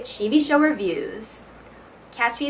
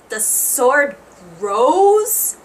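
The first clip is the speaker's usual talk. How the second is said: rising disbelief, louder on grows; incredulous; 3 dB louder, much slower than usual. Acted. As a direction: ask it in stunned disbelief. Disbelief at the sword growing.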